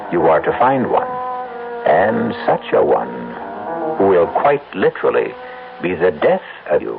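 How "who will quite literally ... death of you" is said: usual speaking voice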